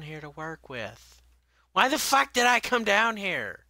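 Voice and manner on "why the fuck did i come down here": angry outburst. Real, not acted. Gamer angry at own choice.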